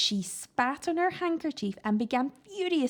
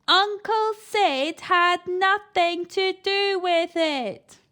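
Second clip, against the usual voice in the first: loud repeat; 7 semitones higher, 6 dB louder, much slower than usual. A narrator's reading. Eric repeats loudly to deaf grandma.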